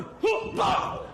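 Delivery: high whooping shout with a pow; a sudden burst of high-pitched preacher shouting; preacher's whoop. Retold or imitated. Whoop as he lays hands on the man. Acts out praying over man.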